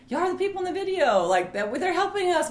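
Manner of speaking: high-pitched imitation of excited fans. Wife quotes people who recognize them from videos, excited.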